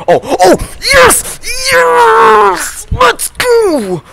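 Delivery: screaming victory yell; high-pitched shouting celebration; raging gamer. Real, not acted. Winning after raging at challenge.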